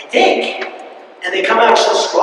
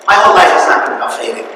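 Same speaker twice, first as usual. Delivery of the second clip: usual speaking voice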